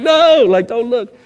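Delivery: high, loud drawn NO; mock-panicked, pitch leaping up; retold flustered protest. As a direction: imitate yourself caught crying, waving them off. Caught crying, waves friends off.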